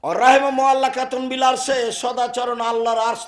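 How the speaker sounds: loud, high hadith recitation; fervent preaching. Acted. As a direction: proclaim it to the gathering. Narrating hadith loudly.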